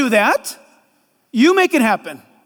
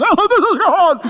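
high, laughing mock awe; 6 semitones higher, 4 dB louder than usual. Preacher laughing at TV shows, high mock awe. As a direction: gasp it with a laugh. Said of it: "those T V shows make us".

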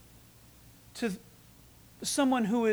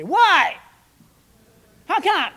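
higher, loud indignant protest; cantankerous protest; 8 semitones higher, 10 dB louder, slower than usual. Retold or imitated. Cantankerous churchgoer refuses the officer, loud and indignant. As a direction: demand it like a stubborn grump. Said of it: "one cantankerous one around here and say".

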